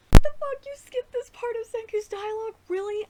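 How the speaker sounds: shouted, high-pitched disbelief; real fan outrage at the skipped dialogue; outraged disbelief. Real, not acted.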